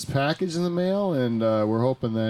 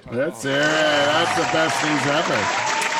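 exaggerated delight; 3 semitones higher, 3 dB louder, much slower than usual. Mock ecstatic reaction.

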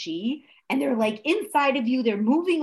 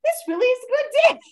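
high-pitched, delighted announcement; happy exclamation; 13 semitones higher, 3 dB louder than usual. Acted. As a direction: say it high and brisk, relishing the good day.

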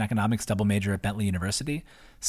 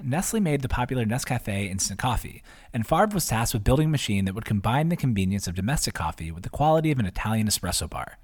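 ordinary delivery

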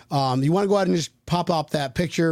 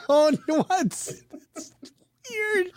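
high laughing disbelief; giggling at something absurd; 12 semitones higher, much slower than usual. Real, not acted. Laughing at the photo.

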